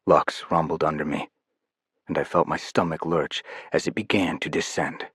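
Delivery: normal talking voice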